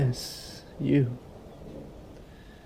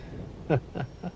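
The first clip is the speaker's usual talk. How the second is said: a soft breathy laugh with a rising lilt; whispered laugh; 7 dB quieter than usual. Acted. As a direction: laugh under the breath, mocking. Vampire laughs at hunter.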